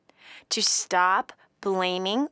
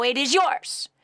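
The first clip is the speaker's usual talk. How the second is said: ordinary talk, nothing marked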